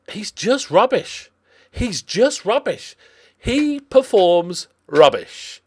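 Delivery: high, drawn-out exasperation; exasperated. Real, not acted. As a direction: drag out the complaint in a high voice. Despairing over a poor player.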